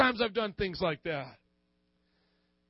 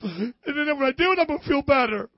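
high, whiny mock crybaby; hammed-up pity-party whine; mock self-pity; 7 semitones higher, 6 dB louder than usual. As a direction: whimper it like you're sulking for pity. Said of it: "It's like you're saying".

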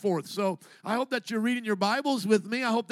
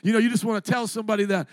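ordinary talk, nothing marked

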